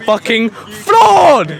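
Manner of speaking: loud, slow, high furious yell; angry shout. Real, not acted. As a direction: bellow it out in frustration. Ranting about the education system.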